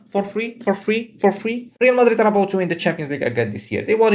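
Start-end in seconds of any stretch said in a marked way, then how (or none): 0.1-1.7 higher pitched, disbelieving for free; mock-baffled repeated query; incredulous rising question. Real, not acted. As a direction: ask it again and again in disbelief.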